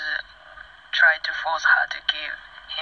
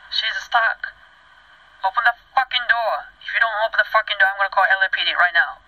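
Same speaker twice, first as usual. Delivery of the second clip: higher-pitched, retold angry ultimatum; acted urgent demand; 4 semitones higher, faster than usual. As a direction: act out pressing someone to act, higher and insistent. Retold demand to security guy.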